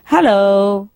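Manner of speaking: calling down from high up, rising in pitch; mock shout. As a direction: hail someone far below, not truly shouting. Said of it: "So he shouted down at her".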